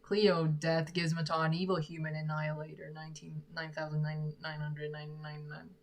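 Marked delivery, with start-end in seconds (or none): none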